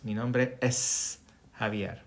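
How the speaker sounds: slow "Mi nombre es Javier", the S of "es" sounded; repeating the model for beginners; sounding the S. Shown as a demonstration. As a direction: lean on the S in "es", then "Javier". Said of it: "you need to pronounce the S".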